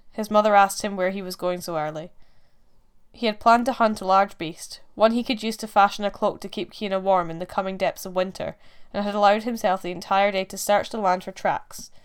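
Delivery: ordinary delivery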